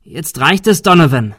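loud, fed-up outburst; high, forceful challenge; angry shout. A narrator's reading. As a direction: yell it to put an end to this. Confronting the gunman.

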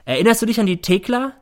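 high, loud challenging question; confrontational. A narrator's reading. Son confronting his mother, challenging. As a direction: throw the memory in the face.

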